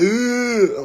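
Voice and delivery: high mock wail; put-on, high-pitched boo-hoo; mock crying. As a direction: imitate a brother's fake bawling.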